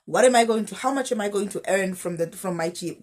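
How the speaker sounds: ordinary delivery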